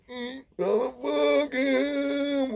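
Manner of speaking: very slow, high, slurred mock deaf speech; mimicking Habao. Deaf inmate Habao explaining overheard plot, slow high mock deaf voice. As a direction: drag each word out high like a deaf man. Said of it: "He said".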